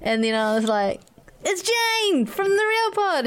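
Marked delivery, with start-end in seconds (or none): 1.4-3.2 high, drawn-out starstruck call; eager fan greeting. Retold or imitated. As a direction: announce yourself to a celebrity, pitched way up and stretched. Retold excited greeting to celebrity.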